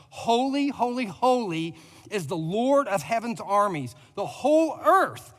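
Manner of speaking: loud ringing proclamation, high on the first Holy; calling out. Acted. Pastor voicing the seraphim calling to each other, loud. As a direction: call it out like angels crying to each other. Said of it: "They were calling out to each other".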